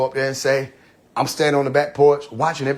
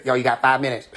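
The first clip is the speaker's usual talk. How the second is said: mock guard voice; 2 semitones lower than usual. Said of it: "They can't come in and say".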